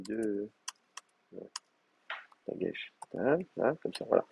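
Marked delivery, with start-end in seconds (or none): none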